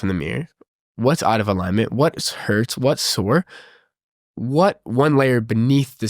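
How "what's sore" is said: normal talking voice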